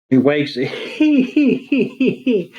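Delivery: high wheezing laugh mid-sentence; breaking into laughter. Real, not acted. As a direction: crack up and lose the sentence, dragging it out. Cracks up while telling the scene.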